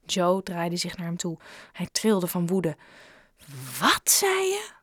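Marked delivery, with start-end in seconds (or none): 3.8-4.7 high outraged yell; shout. Acted. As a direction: explode at him in disbelief. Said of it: "schreeuwde hij".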